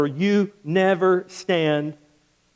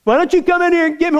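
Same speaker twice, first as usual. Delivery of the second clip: much higher, louder, fast taunting dare; bold taunt; 10 semitones higher, 4 dB louder, much faster than usual. Retold or imitated. Preacher taunts the king from the furnace, fast loud dare. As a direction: throw the challenge right back. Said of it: "my response to him would have been".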